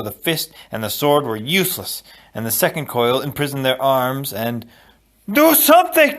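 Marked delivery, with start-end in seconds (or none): 5.3-6.2 high, loud desperate cry for help; panicked cry. Acted. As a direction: scream at him to act before it's too late. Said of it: "Inigo cried".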